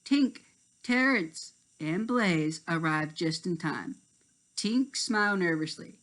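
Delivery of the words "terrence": ordinary delivery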